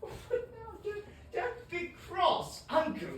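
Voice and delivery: high, pleading coax; coaxing plea. Acted. Nephew Fred coaxing his cross uncle, high and pleading. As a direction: wheedle him gently.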